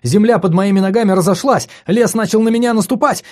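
high, loud, fast feverish babble; frantic rush. Acted. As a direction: spill it out in a frenzy. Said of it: "рассказывать оживлённо и быстро".

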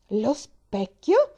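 loud, drawn-out indignant question; angry. A narrator's reading. Invalid reacting to mention of the mirror, angry. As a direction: echo it slowly and high in outrage. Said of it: "si arrabbiò la malata".

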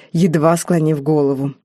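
plain reading voice

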